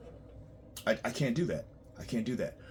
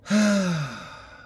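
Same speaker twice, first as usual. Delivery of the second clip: exasperated sigh; 7 semitones higher, 6 dB louder than usual. Real, not acted. Exasperated about clients.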